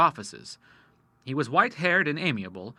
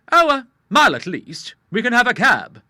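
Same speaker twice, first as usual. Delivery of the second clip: a younger man, raised and uneasy; a suspicious young man speaking out; Charles; 10 semitones higher, 10 dB louder than usual. A narrator's reading. Charles to the Admiral in the street, lightly voiced and uneasy.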